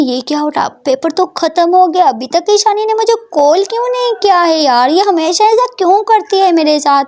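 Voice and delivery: annoyed friend. Acted. Prita annoyed waiting for call.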